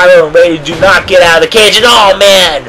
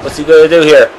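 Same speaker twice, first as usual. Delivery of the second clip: normal talking voice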